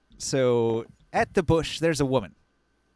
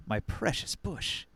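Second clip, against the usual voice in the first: doting coo; 3 semitones lower, 7 dB quieter, slower than usual. Acted. Woman coos over her bush.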